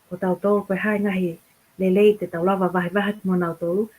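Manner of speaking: ordinary talk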